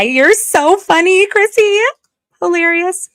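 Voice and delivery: high and loud, drawn-out funny; squealing laughing praise; delighted squeal. Real, not acted. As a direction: cheer a viewer's joke. Reacting to a chat joke.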